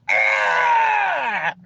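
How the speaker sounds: high hammed-up wail; a fake crybaby shriek; high mock cry. Mock wail after quote.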